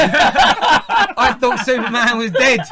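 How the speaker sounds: high, loud, slow, laughing through the words; laughing mock shock. Host laughing in mock outrage at spoiler, loud.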